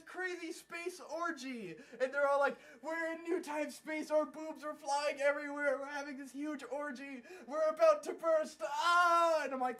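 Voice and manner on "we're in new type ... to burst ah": raised voice building to a loud cry; mock ecstasy. Pilots merging in space, mock ecstatic frenzy. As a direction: build from excited chant to a hammed-up climax shout.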